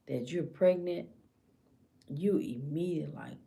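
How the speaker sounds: normal talking voice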